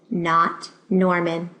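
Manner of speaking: usual speaking voice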